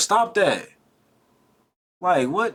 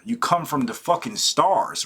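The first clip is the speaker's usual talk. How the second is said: ordinary delivery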